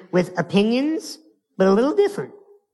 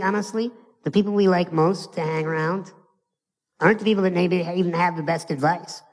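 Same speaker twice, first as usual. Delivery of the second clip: ordinary delivery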